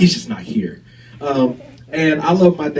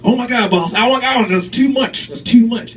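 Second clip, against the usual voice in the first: higher, gasping protest; shocked underling; 7 semitones higher than usual. Acted. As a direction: pull back in mock shock. Underling shocked by boss's idea.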